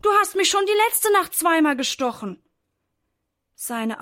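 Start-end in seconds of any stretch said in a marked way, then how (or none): 0.0-2.4 high, loud scolding outburst; raised, exasperated storybook shout; loud angry call. A narrator's reading. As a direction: call out fed up at a pest. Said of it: "ruft ganz laut".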